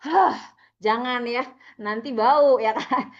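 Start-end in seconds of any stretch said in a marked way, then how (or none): 0.0-0.4 loud, abrupt "hah" puff of breath; demonstrating a too-fast breath out; sudden forceful exhale. Shown as a demonstration. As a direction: blow all the air out at once, the wrong way. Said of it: "buangnya pelan-pelan. Jangan".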